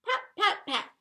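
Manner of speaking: playful pats. Acted. Yeti patting the sad bird, giggled out playfully.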